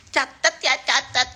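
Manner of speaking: mocking imitation. Host mimics a rival's voice giving orders, mocking imitation. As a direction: mimic her small voice lightly. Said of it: "Suaranya gitu".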